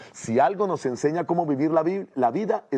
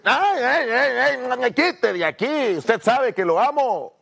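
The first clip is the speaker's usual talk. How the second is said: high, loud exasperated whine; annoyed brush-off; 12 semitones higher, 5 dB louder, slower than usual. Acted. Wife bothered by husband's hug, irritated brush-off. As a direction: fend off a pest while claiming love. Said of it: "con las expresiones, con los gestos".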